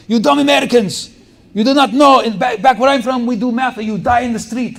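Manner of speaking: high, loud mock Ukrainian professor rant; Ukrainian professor yelling. Professor yelling at dumb American students, mock angry accent. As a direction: bark it at the class like an angry foreign professor. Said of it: "He would always say that".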